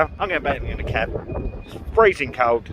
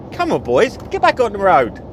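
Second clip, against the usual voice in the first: teasing call; 9 semitones higher, 6 dB louder than usual. Real, not acted. Teases the other drivers.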